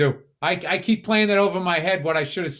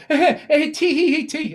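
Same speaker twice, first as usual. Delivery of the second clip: mocking giggle; 9 semitones higher, 4 dB louder than usual. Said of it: "he was going like".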